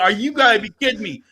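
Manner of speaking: high, loud incredulous rant; pissed-off protest. Retold or imitated. Dad calling up to champion his son, angry disbelief. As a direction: argue it hotly, at full tilt. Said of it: "I'm pissed".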